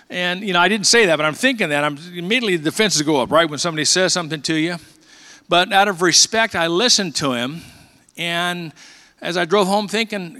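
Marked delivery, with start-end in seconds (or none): none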